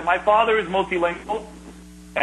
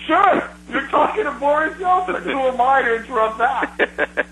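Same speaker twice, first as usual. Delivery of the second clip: sarcastic; 8 semitones higher than usual. Retold or imitated. Sarcastic reply to the Yeltsin claim.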